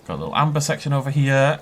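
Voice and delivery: ordinary talk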